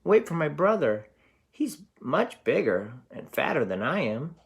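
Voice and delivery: high, pleading little goat voice; trembling little goat. Acted. Little goat pleading with the troll, high and frightened. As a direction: beg the troll not to eat you, tiny and scared. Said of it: "trembled where he stood".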